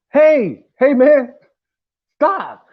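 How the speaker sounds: high, loud repeated call to break up a fight; shouting to stop them. Retold or imitated. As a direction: holler to get their attention. Yelling at arguing people on bus.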